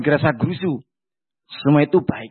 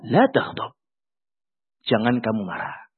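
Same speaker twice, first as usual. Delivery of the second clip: the Prophet's reply; 9 semitones lower, 4 dB quieter than usual. Acted. Prophet's short answer.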